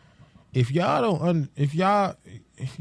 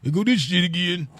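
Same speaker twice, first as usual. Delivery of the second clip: put-on older voice, higher-pitched; mock old-timer voice; sixty-year-old self; 4 semitones higher than usual. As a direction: imitate yourself at sixty, pitched up. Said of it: "I'm gonna be sixty years old".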